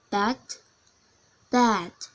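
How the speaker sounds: usual speaking voice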